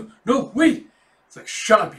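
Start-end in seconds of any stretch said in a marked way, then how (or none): 0.0-0.9 high, loud panicked begging; panicked pleading. Acted. Governor panics under arrest. 1.5-2.0 loud, angry shut up; angry shout. Acted. Soldier silences prisoner.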